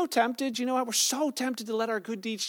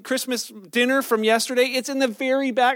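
ordinary talk

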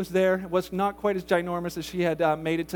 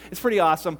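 normal talking voice

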